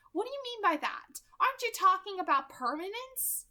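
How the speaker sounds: high, sharp questioning Rosalie; a young woman's startled suspicion; surprised, suspicious Rosalie. Acted. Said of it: "surprised and suspicious".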